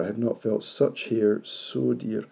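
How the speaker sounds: "so" lifted and held in "such hair so dear"; slow, lean on "so"; stress on so. Shown as a demonstration. Teacher marking the emphasis on "so" for the class. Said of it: "The stress there".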